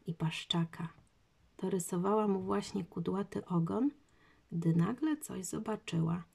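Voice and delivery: ordinary delivery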